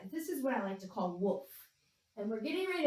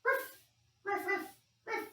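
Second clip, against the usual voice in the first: playful dog barks; 10 semitones higher than usual. Pretend. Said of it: "And one more dog".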